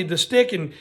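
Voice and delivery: usual speaking voice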